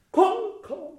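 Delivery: high, fearless shout of "コンコン"; bold shout. Shown as a demonstration. Coach shows the choir a bold, high shout. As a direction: fling it out without fear. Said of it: "だから、臆せず".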